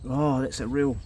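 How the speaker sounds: ordinary talk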